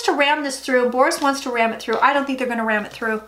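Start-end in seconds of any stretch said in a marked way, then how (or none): none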